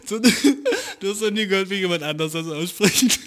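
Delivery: can't hold the laugh in; laughing through words. Real, not acted. Mocking the dude's pronunciation, laughing through it.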